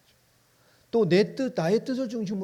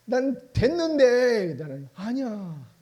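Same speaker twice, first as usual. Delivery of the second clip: slow, loud, higher grumble; whiny complaint; 6 semitones higher, 5 dB louder, much slower than usual. Acted. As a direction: sulk that it's unfair. Believer's complaint acted.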